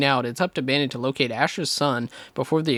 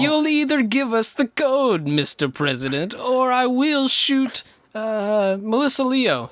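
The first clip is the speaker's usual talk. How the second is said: high, slow hammed-up villain; mock villain; 9 semitones higher, slower than usual. Mocking the villain's demand to the President, high and slow. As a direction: ham up a cartoon villain threat. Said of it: "They're like".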